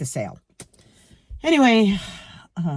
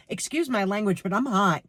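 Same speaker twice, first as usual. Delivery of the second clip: ordinary talk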